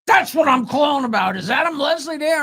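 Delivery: imitating a furious caller, loud at first; infuriated. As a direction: yell down the line at the culprit. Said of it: "was infuriated".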